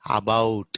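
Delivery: about with a full "aa" at the start; clearly sounded first vowel in "about"; Telugu-English pronunciation. Shown as a demonstration. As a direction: say "about" as "aa-bout". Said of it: "we say".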